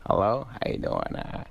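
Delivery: soft, lightly voiced goofy comic; a hint of mumbling comic on the phone; Adam Sandler. Comedian answering a young fan's call, lightly impersonated. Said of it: "Adam got on the phone".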